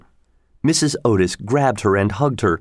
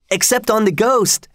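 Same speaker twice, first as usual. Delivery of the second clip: shouted; 11 semitones higher than usual. A narrator's reading. Twins shouting at their father, high and gleeful. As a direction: yell it out like cheeky twins. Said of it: "shouted the twins".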